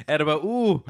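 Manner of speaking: failed Irish that lands nearer Klingon; botched Irish accent. Shown as a demonstration. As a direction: try Irish and miss completely. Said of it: "You sound more like a Klingon".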